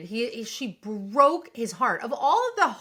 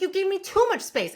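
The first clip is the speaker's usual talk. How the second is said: mock exasperation; 9 semitones higher, 3 dB louder than usual. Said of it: "And she's like".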